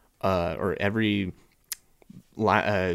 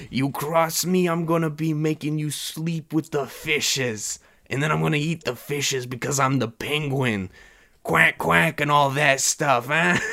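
hammed-up wiseguy voice, much higher and louder; mock Penguin mobster voice; 7 semitones higher, 4 dB louder than usual. Vlogger mocking the Penguin's voice, in a hammed-up, much higher mobster voice. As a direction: ham up a goofy gangster bird. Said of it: "a penguin who's walking around going".

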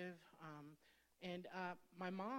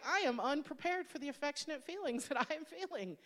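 laughing confession; 8 semitones higher, 8 dB louder than usual. Retold or imitated. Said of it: "I was like".

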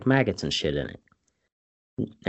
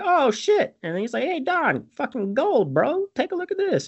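high-pitched, mock-excited quote; excited discovery. Imitating Toby showing Don the gold, excited and high. Acts out finding gold in horse.

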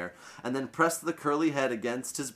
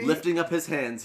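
ordinary delivery

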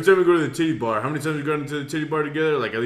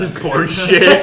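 laughing; 3 semitones higher, 7 dB louder, slower than usual. Real, not acted. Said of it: "echoing with a laugh".

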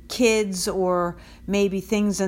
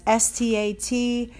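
spelling out; 2 semitones higher than usual. Shown as a demonstration. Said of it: "spelling out the address".

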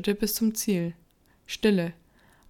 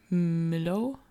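drawn-out, hesitant question, rising at the end; trembling. A narrator's reading. André sees his dead dog, hesitant and trembling. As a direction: bring the name out haltingly, mildly shaken. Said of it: "brachte André zitternd heraus".

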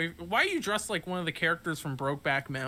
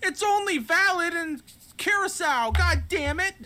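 put-on high kid voice; upset kid; 10 semitones higher than usual. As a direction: complain like a frustrated kid. Kid upset about gift card.

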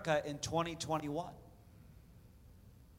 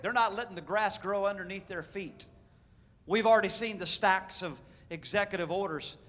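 ordinary delivery

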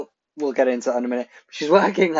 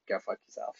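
muttered go fuck yourself; 6 semitones higher, 16 dB quieter, faster than usual. Retold or imitated. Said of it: "a laugh, like".

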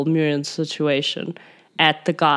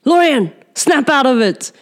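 urgent call; 7 semitones higher, 4 dB louder than usual. Acted. Player snaps captain out of trance.

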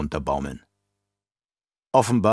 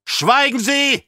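much higher, louder roar; roaring command; 7 dB louder, slower than usual. Acted. Emperor roars at the baron to be silent. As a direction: bellow him into silence. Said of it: "brüllte der Imperator".

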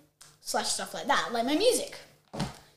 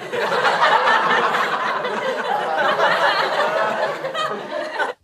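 laughing; 5 semitones higher than usual. Real, not acted. Laughs after prank.

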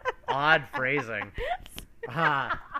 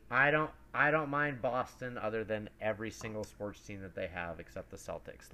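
usual speaking voice

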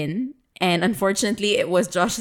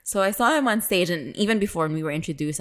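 usual speaking voice

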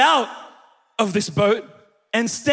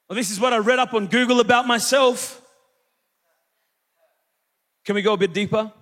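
ordinary talk, nothing marked

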